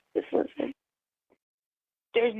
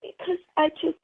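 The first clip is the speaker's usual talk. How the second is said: tearful pleading; 6 semitones higher than usual. Real, not acted. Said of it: "Don't give me a fake cry".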